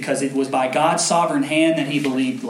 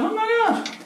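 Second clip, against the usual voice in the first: much higher, giddy disbelief; retold excitement; 4 dB louder than usual. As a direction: gush in thrilled disbelief. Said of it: "And I was like".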